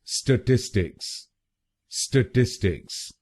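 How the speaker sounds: स्टैटिस्टिक्स with short schwa "stə-tis-tiks", stress on the middle; correct pronunciation. Shown as a demonstration. Teacher models the right way for viewers. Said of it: "इसकी सही pronunciation है".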